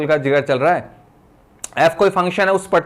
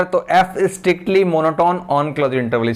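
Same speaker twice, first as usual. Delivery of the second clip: very slow, word by word, for note-taking; slow dictating teacher; slow dictation; much slower than usual. Shown as a demonstration. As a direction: read it out slowly while students write. Dictating theorem for notes.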